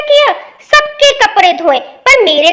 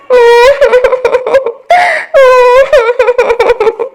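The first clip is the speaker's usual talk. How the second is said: hammed-up sobbing cry; fake wailing; 3 semitones higher than usual. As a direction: wail theatrically to win sympathy. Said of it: "क्यों रो रही हो?".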